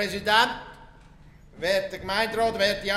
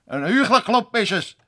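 higher, louder, furious stop it; bitter man cutting off his friend's advice, angry; angry outburst; 4 semitones higher, 5 dB louder than usual. Acted. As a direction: snap it at him, fed up. Heated quarrel about council.

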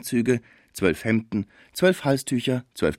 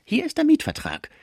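much higher-pitched villain's voice; dreadful adversary. A narrator's reading. The dreadful lawyer shows the lease to officials, high-voiced. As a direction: produce the proof in a high, menacing voice. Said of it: "sagte der schreckliche Fraisier".

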